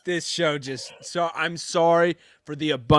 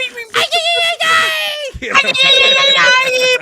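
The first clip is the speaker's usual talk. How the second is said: piercing, very high scream; shrill high-pitched hype scream on request; 10 dB louder than usual. Pretend. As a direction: let out a shrill shriek. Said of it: "[name]'s shrillish high pitch scream".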